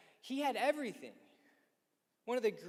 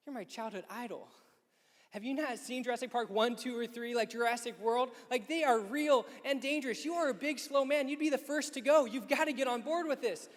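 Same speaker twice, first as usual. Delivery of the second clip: higher, louder urgent pleading; incredulous pleading; 6 semitones higher, 4 dB louder than usual. Retold or imitated. [name] urging Shaq that dinosaurs are real, rising incredulous rant. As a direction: plead with your childhood idol.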